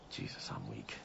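barely getting the words out, drained; weak and hurt. Acted. Injured son tells his mother he is weak, faint murmur. Injured man after a fight.

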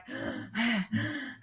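exaggerated imitation panting; high, mock winded gasps; out-of-breath gasping. Imitating the winded man who ran after them, gasping. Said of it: "like, super out of breath".

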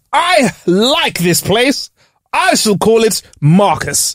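high, slow grand explorer voice; posh explorer. Pretend. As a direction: proclaim like a pompous discoverer. Imagined explorer naming place.

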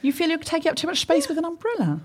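ordinary talk, nothing marked

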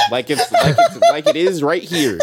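laughing high and loud; words breaking into laughter. Real, not acted. Laughing while reading instruction.

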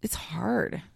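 hushed, whispery admission of grief; real sadness, very quiet; emotional, subdued. Real, not acted. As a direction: say it softly, barely voiced, after a long pause. Emotional about grief after long pause.

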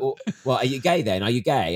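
loud imitated schoolkid challenge; loud mock interrogation; nosy schoolmates. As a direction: press the question loudly, like a classmate. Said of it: "And they're like".

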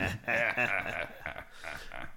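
mock evil cackle; hammed-up goblin laugh; villain cackle. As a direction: cackle like a supervillain. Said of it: "see yourself cackling".

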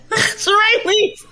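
squealed high through laughter; high laughing protest; laughing plea. Real, not acted. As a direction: beg her to stop, cracking up.

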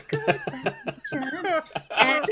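high, drawn-out words through laughter; slow, giggling repeat; laughing. Real, not acted. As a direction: crack up as you say it. Cracks up repeating her own words.

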